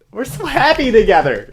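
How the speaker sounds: very high, loud lovey-dovey singsong; hammed-up cheery gush; mock gushing couple. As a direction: parody a sugary couple.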